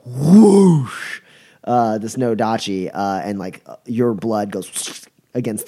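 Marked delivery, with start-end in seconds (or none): 0.0-1.2 loud swooshing blade noise, higher; sword whoosh. Acted. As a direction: swing the sound out big. GM voices sword swing sound.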